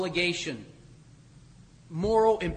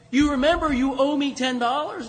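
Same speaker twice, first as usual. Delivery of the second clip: louder, higher, demanding; demanding creditor; 6 semitones higher, 7 dB louder than usual. Retold or imitated. Forgiven man confronting his neighbour over ten dollars, loud demanding.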